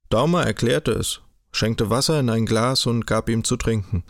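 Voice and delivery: usual speaking voice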